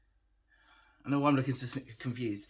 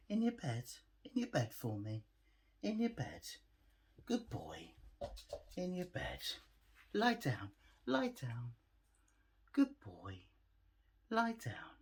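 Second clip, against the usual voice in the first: gentle dog commands; 6 dB quieter than usual. Real, not acted. Settling the puppy with commands.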